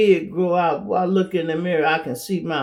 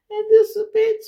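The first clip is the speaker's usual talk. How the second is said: much higher and louder, outraged squeal; indignant disbelief; 5 dB louder than usual. Retold or imitated. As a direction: shriek it in disbelief. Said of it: "You be like".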